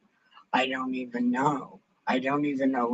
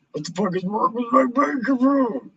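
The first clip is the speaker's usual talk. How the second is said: frustrated outburst; 8 semitones higher than usual. Real, not acted. Streamer yelling at a dead microphone, exasperated. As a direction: let it burst out, fed up.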